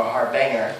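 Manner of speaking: HARBANGER as har-BANG-er, no j sound; mispronunciation. Shown as a demonstration. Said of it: "It's not pronounced".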